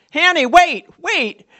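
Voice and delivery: high, loud call to wait; calling after her. Retold or imitated. Little boy calling after sister.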